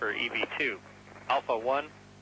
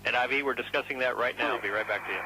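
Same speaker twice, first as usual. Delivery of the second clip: radio transmission; 3 semitones lower than usual. Real, not acted. Mission control radio reply.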